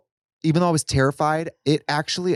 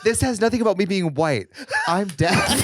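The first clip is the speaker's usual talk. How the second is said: laughing quote; 6 semitones higher than usual. Retold or imitated. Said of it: "You said".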